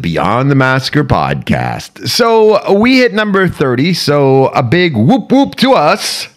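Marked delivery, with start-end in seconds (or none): 2.1-6.3 excited. Real, not acted. Celebrating episode thirty.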